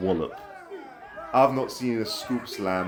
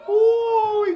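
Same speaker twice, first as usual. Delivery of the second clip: excited ooh; 7 dB louder than usual. Real, not acted. Big chop lands in wrestling match.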